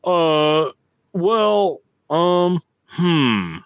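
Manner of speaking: mock hesitation. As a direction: stall for words, hamming up the awkwardness. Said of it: "I still am like".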